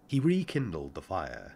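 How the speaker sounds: ordinary delivery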